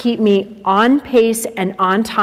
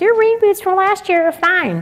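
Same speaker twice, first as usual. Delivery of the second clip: much higher mocking singsong; mocking mom; 10 semitones higher than usual. Teen mocking mom's reply, high singsong. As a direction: mimic mom in a high nasal-free whine. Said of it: "and she's like".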